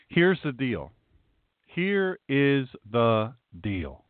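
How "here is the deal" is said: each word separated, very slow; slow and deliberate. Retold or imitated. Pastor saying what you tell someone in a dispute, slow and deliberate. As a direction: spell it out word by word.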